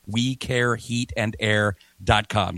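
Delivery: slow, each word set apart for the listener; said slowly. Shown as a demonstration. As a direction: spell out the web address slowly so people catch it. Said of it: "I'm gonna say it again slowly".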